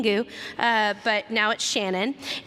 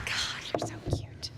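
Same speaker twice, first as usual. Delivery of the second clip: soft breathy whisper, adoring; 8 dB quieter than usual. Real, not acted. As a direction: coo it under your breath. Cooing at a fan.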